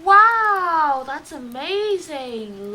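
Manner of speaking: put-on kids' show host hamming up awe; amazed wow. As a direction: gasp out the wow, then savour it slowly. Host marvels at miracle.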